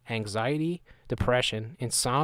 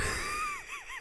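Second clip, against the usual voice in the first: high squealing laugh burst; shrieking laugh; 9 dB quieter than usual. Real, not acted. Laughing at the word Miamians, squealing laugh.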